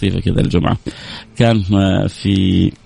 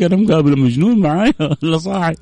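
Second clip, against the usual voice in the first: high laughing disbelief; laughing aside; 7 semitones higher than usual. Retold or imitated. The anchor's thought retold by the host, laughing. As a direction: laugh as you wonder aloud.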